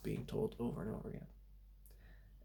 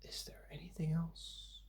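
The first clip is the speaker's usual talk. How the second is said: whispering; 6 semitones higher, 7 dB quieter than usual. Real, not acted. Muttering a question to himself.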